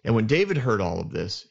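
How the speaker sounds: normal talking voice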